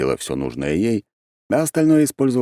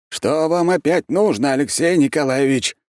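displeased; 10 semitones higher, 4 dB louder than usual. A narrator's reading. Архимаг greets his evening visitor, displeased. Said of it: "недовольно ответил Архимаг".

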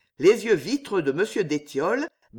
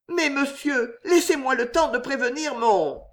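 put-on high, pleading young woman; anxious plea; 7 semitones higher than usual. A narrator's reading. Jeanne begging d'Étiolles for time, pleading. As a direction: plead in a raised voice until cut off.

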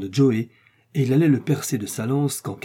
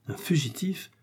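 hushed, higher-pitched startled question; with emotion; 5 semitones higher, 6 dB quieter than usual. A narrator's reading. Samuel reacting to news of a man being hunted, with emotion. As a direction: softly echo it in moved disbelief. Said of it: "dit Samuel avec émotion".

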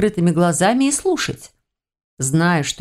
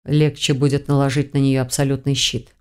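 ordinary delivery, nothing marked

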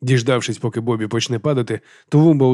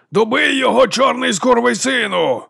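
high, drawn-out yell; shouted urging; 12 semitones higher, slower than usual. A narrator's reading. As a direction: holler it slowly from the crowd. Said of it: "гукнув Ендрю".

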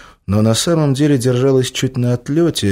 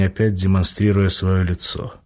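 usual speaking voice

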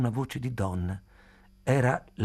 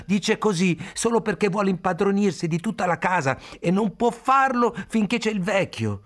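high, loud desperate shout; 10 semitones higher, 4 dB louder than usual. A narrator's reading. Khalil to the grandmother, shouting high and loud. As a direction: cry out to stop them. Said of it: "gridò".

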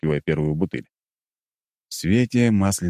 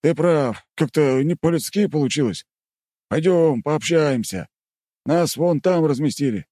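higher, awkwardly smiling guardsman; awkward grin; 10 semitones higher than usual. Acted. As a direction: say it with a sheepish grin. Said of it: "нелепо улыбаясь".

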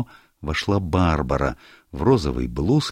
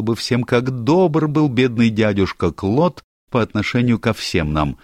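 higher, brighter-voiced girl, teasing; lively young woman; 8 semitones higher, 5 dB louder than usual. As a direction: play Barbara breezing into the room, higher and louder. Barbara voiced by narrator.

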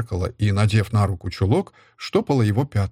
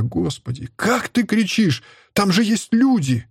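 pained reproach; 9 semitones higher than usual. A narrator's reading. Мать упрекает кричащего отца.

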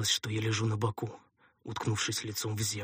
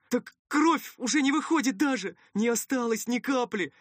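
high, alarmed protest; frightened underling arguing back; panicked helper; 13 semitones higher, 5 dB louder than usual. Acted. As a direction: blurt it out in high-pitched panic. Helper panics over lost blood.

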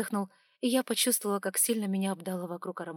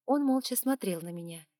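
ordinary delivery

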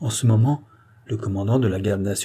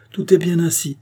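higher, decisive call; general's commanding tone; 8 semitones higher, 4 dB louder than usual. A narrator's reading. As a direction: command it like a general mid-manoeuvre. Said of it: "du ton d'un général". (ton de général qui commande)

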